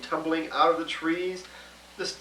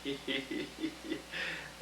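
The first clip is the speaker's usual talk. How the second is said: soft giggle; 3 semitones lower, 14 dB quieter than usual. A narrator's reading. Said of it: "He began to giggle".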